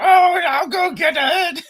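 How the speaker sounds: high, slow granny voice, laughing; squeaky old granny from Count Duckula; man doing a woman's voice. Retold or imitated. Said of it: "the granny from, uh, Count Duckula".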